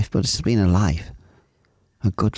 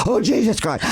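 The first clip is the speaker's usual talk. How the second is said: high-pitched mock alarm; acted-out gasp of fright, pitched up; retold shock; 13 semitones higher than usual. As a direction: cry it out high, re-enacting a sudden drop. Re-enacts the plane suddenly dropping.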